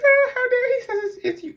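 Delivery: very high, loud offended squeal; mock outrage. YouTuber imitating viewers hearing the blame, mock indignant squeal. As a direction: play an indignant viewer, hammed up.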